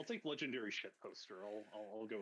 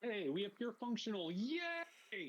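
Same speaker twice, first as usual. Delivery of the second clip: high, loud happy announcement; raised pitch, celebrate with a long yay; excited cheer; 13 semitones higher, 7 dB louder, slower than usual. Real, not acted. Streamer cheering that the stream works, excited.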